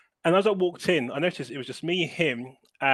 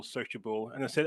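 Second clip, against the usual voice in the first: ordinary talk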